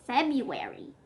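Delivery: plain reading voice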